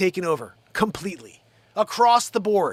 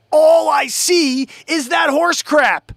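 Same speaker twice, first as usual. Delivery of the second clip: loud, high, drawn-out rant; high, slow, exasperated holler; exasperated outburst; 8 semitones higher, 7 dB louder, slower than usual. Real, not acted. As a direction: stretch it out in a loud, fed-up cry. Exasperated rant about his feed.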